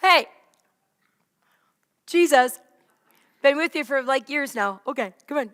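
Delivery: calling out loudly across the water; loud cheerful greeting to the disciples on the boat; loud friendly call. Acted. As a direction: hail them loudly, like an old friend. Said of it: "And he says".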